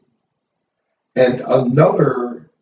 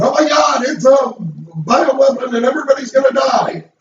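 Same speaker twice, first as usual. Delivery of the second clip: shrill-free but much higher, hammed-up alarmist cry; mock panic; 11 semitones higher, faster than usual. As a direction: play a panicking doomsayer, voice jumping high. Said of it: "not blowing up, going".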